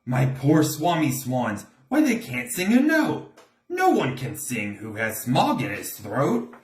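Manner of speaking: high, croaky complaining creature; Lorax crying out. A narrator's reading. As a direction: plead in a cracked croak. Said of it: "he cried with a cruffulous croak".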